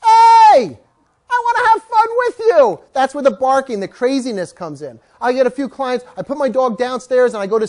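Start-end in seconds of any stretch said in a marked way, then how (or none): 0.0-2.8 much higher, louder eager dog voice; be the lonely dog begging to play; voicing the dog. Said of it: "Guess what your dog is thinking?".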